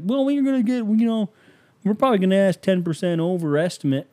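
high, put-on voice hedging an excuse; imitated seller. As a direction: pitch it up like a seller sheepishly justifying the price. Acting out homeowners' excuse to buyer.

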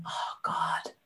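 retold private whisper of dread. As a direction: whisper it under your breath. Retells her own silent dread.

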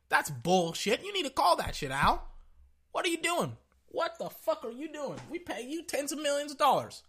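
high, heated chewing-out; imagined commissioner scolding. Acted. Commissioner scolding the referee chief, heated and high. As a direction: dress him down like his boss would. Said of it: "Roger Goodell not come out and say".

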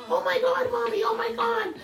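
very high, loud imitation of an overwhelmed daughter; shocked cry. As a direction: squeal it like stunned good news. Daughter's shocked reaction, then crying.

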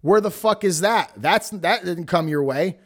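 usual speaking voice

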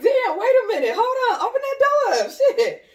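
high, loud, laughing it out; laughing exclamation. Retold or imitated. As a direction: blurt it while cracking up. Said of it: "I'm like".

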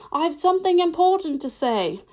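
high, carrying call; calling after someone. A narrator's reading. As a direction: keep calling out at a high pitch. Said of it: "the caterpillar called after her".

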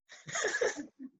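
high laughing burst; burst of laughter. Real, not acted. Laugh while quoting manager.

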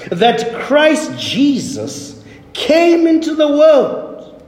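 very slow, spaced-out phrases; solemn scripture reading. Retold or imitated. Preacher reads Paul's saying to the congregation, slow and solemn. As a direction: read it out with great deliberation, drawing out each phrase. Reads the key verse aloud.

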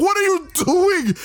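very high mock shriek; upset Barbara. Barbara confronts a rampaging Bruce, high upset cry. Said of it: "Barbara was so upset, she's like".

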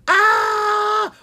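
very high, loud terrified scream. Acted. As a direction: shriek in terror. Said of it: "Owen screamed".

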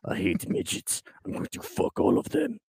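hushed, hammed-up threat; mock-menacing whisper. Podcaster keeps up the joke rant, mock-sinister whisper. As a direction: drop from ranting into a whisper. Comic rant drops to hush.

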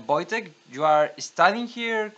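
normal talking voice

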